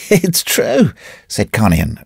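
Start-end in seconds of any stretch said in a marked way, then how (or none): 0.1-0.9 dry cackle. A narrator's reading. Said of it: "with a dry cackle".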